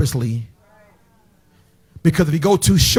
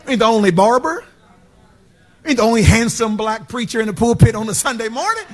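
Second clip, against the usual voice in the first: rising preacher's cadence, each line lifting; sermon build, calling out the crowd; building preaching; 5 semitones higher than usual. Acted. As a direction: stack the phrases and climb. Preacher builds rhetorical run.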